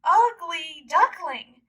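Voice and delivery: mean-spirited mocking voices; mocking ducks. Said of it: "They said".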